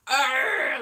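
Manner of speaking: loud throaty pirate "ah"; pirate cry. Shown as a demonstration. Comic straining own voice as pirate, loud growl. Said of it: "that really does your voice in".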